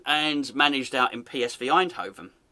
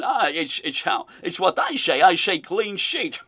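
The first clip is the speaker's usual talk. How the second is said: slushy-S fake Dutch accent; mock Dutch accent. Host voicing manager's new phrase, mock Dutch accent. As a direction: play the manager proudly faking Dutch-English. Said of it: "he's basically saying".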